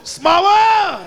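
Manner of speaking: put-on high, loud, drawn-out kid yell; kid hollering. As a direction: let it soar like an unruly child's shout. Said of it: "I heard something go".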